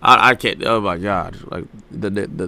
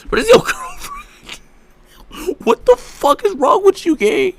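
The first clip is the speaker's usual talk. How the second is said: disbelief; much slower than usual. Real, not acted. To a streamer about his girlfriend, a shocked high crack. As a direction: voice cracking high, stretched and slow.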